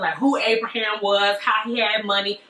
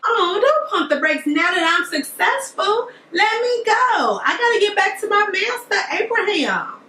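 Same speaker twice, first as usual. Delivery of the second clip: high insistent servant; 9 semitones higher than usual. Acted. Servant begging the family to let him go, high and urgent. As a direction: push back high and urgent.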